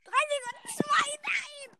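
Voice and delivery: high tense count, then a cry; excited countdown. Real, not acted. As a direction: count down, then despair breaks out.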